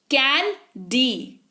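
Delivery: slow "कैन, डी" with a gap between; syllable by syllable. Shown as a demonstration. Teacher showing mouth movements, "कैन, डी" slowly split in two. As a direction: draw out "कैन", then separately "डी". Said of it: "मतलब आपने मुंह खोला".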